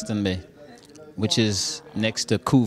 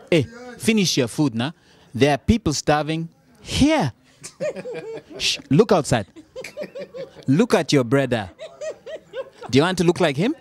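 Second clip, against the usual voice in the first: high, loud, laughing African parent scolding; mock-stern African mum warning the kids; 6 semitones higher, 3 dB louder than usual. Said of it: "what do African parents tell their kids".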